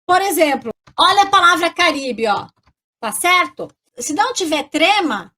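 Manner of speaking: normal talking voice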